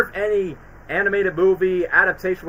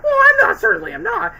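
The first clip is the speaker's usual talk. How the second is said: much higher, squeaky put-on voice; mock prissy voice; 5 dB louder than usual. Replaying the movie denial in a high squeak. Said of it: "you're joking about that".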